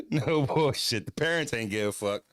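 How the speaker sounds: usual speaking voice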